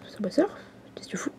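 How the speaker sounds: hushed aside under the breath; whispered aside. Real, not acted. A kid whispers to himself while the game loads. Muttering at the slow tablet.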